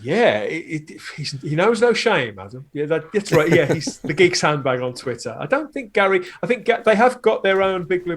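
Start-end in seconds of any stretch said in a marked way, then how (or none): none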